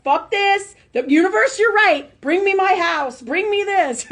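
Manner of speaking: high, loud mock shout of surrender; exasperated outburst. Telling the listener how she gave in to the universe, loud and high. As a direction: throw up your hands and yell it out.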